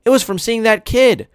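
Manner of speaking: put-on high little boy voice. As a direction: play the young brother, excited. Jamie voiced.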